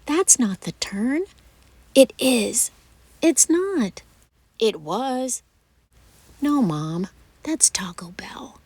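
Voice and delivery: stubborn tit-for-tat with mom; bickering argument. Acted. Acted quarrel, mom and child.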